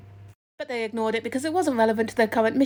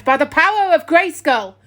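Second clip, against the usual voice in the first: heroic war cry; 5 semitones higher, 7 dB louder than usual. A narrator's reading. Sword inscription war cry.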